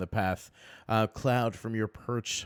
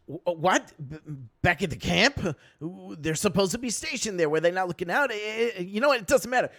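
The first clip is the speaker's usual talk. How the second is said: goblin knight; 11 semitones higher, 4 dB louder than usual. Acted. Goblin knight flustered by the news.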